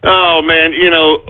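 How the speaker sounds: ordinary talk, nothing marked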